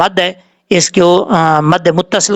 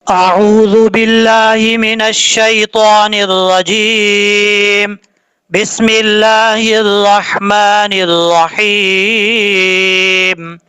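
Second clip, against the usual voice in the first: melodic recitation; 3 semitones higher, much slower than usual. Shown as a demonstration. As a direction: recite with a full tune. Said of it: "अब हम इसको पढ़ते हैं लहजे में".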